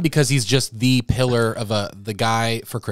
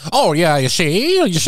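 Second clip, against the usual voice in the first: higher-pitched, slow forties gangster patter; hammed-up old movie voice; old-time movie talk; 6 semitones higher, 3 dB louder than usual. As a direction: mimic an old film tough guy. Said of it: "how they talked back then".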